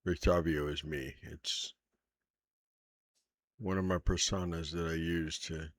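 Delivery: put-on earnest, probing older man; steady, serious inquiry; lightly voiced old hunter. GM plays Van Richten confessing.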